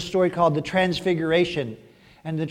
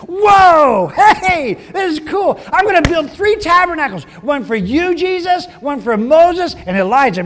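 much higher, loud gushing excitement; excited Peter; 11 semitones higher, 10 dB louder than usual. Retold or imitated. Peter gushing to Jesus about building tabernacles, high and loud. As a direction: Peter swept away, shouting with glee. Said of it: "caught up in the emotion and excitement".

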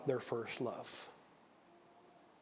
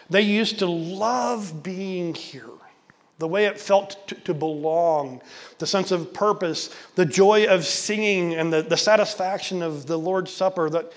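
louder, slowing preacher's push; impassioned preaching; 4 semitones higher, 6 dB louder, slower than usual. Real, not acted. Preacher calls wandering members back, impassioned. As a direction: urge the flock back.

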